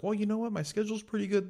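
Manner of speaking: high-pitched mock phone voice; caller on speaker. New coworker on a doctor's call, high mocking voice. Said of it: "He's like".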